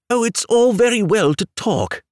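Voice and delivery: sulky little complaint; pettish. Acted. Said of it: "rather pettishly".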